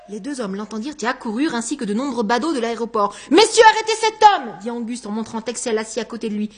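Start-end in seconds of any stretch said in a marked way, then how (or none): 3.3-4.6 loud, high-pitched call to police; urgent shout. Acted. Man calling officers to arrest his neighbour, shouting. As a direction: shout an urgent demand for help.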